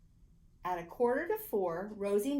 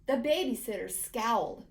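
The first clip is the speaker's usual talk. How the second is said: plain reading voice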